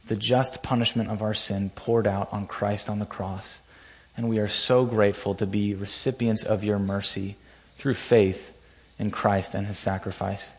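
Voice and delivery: prayer. Acted. Pastor leads opening prayer.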